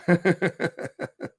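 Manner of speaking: chuckle. Real, not acted. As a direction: chuckle steadily to yourself. Amused after reading his own name.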